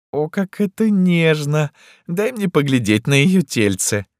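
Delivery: high, slow theatrical cooing; swooning theatrical ruler; gushing emperor. A narrator's reading. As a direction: coo in a high, affected voice.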